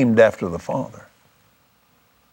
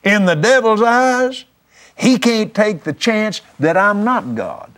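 "devil's eyes" held high, deliberate restatement; pointed emphasis; 8 semitones higher than usual. Real, not acted. Preacher carefully restating his claim to listeners, pointed emphasis. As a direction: drive home "devil's eyes" in a high, insistent pitch. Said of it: "hear this the way I'm saying it now".

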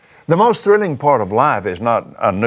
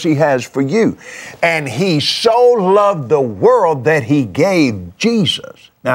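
slow, earnest preaching to the listener; slow sincere appeal; tender altar call; 4 semitones higher, slower than usual. Acted. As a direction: plead gently, one phrase at a time.

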